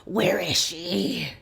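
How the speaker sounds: drawn-out, strained rasp; slow, hammed-up rasping question; desperate rasped demand. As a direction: imitate a wounded man's demand, very slowly. Marshal grabs Jack, demands answer.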